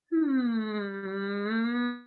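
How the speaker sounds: drawn-out closed-lip hum, dipping and rising; long hum. Shown as a demonstration. Coach reversing the shoulder circle, long hum. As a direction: keep one hum going. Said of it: "continue the hum".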